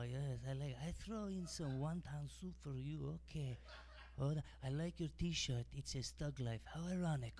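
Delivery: imitating the restaurant clerk with a hammed-up Chinese accent; mock Chinese accent. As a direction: play the chatty takeout cashier in a put-on accent.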